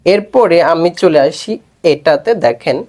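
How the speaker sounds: ordinary delivery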